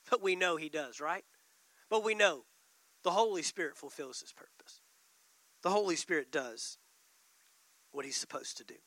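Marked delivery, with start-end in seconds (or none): 3.0-8.6 unvoiced, hushed; whispered. Real, not acted. Pastor assuring the church, whispered. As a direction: drop it to a whisper. Sermon point, dropped to whisper.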